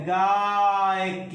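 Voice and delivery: गायक drawn out very slowly as गाय-क; गायक को धीरे खींचकर बोलना; slow syllable split. Shown as a demonstration. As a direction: stress the आय sound in गायक slowly. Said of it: "उच्चारण लगाइए तो".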